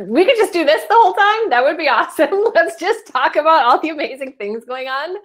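high, fast, laughing gush; bubbling over with glee; playful giddy enthusiasm; excited laughing aside. Real, not acted. Excited aside about chat.

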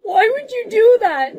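high, loud, drawn-out protest; upset. Acted. Teased girl confronts her bully, upset. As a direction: cry it out, hurt and loud.

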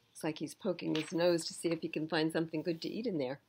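usual speaking voice

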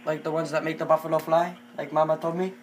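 put-on halting Indian-accented English. Prank caller asking a wings place for llama, in Indian accent.

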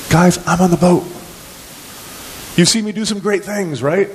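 high-pitched, incredulous protest; exasperated Jesus. Acted. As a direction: chide the disciples in mock disbelief. Paraphrases Jesus chiding his disciples.

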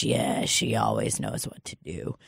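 put-on old Donald voice. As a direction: sink deep and soft like drowsy Donald.

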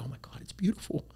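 breathy high whisper of amazement, a touch fast; gushing awe. Retold or imitated. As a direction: gasp it out like a dazzled homeowner. Clients gushing over new kitchen.

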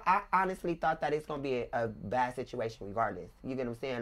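normal talking voice

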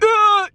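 high, loud effort yell; straining shout. Retold or imitated. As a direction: yell like lifting a heavy squat. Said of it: "my exact words during the squat".

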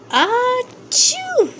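high, hammed-up sneeze sound; a big comic sneeze. A narrator's reading. Sneeze in story refrain.

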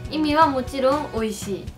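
ordinary delivery

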